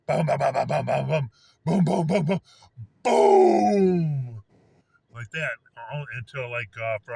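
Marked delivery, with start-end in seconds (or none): 0.1-4.4 loud rapid bums building to one huge boom; imitated firework noises. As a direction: mimic a barrage, then a long explosive falling boom. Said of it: "these are the noises I hear".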